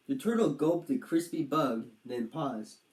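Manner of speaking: plain reading voice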